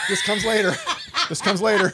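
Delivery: real laughter while insisting; amused protest. Real, not acted. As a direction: hold off the joke through a laugh. Fends off friend's lyric joke, amused.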